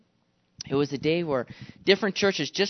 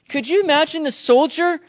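ordinary talk, nothing marked

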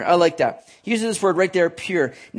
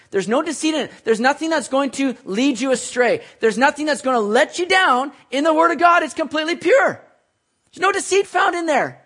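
rising preacher fervour; 8 semitones higher, 4 dB louder than usual. Acted. Preaching climax.

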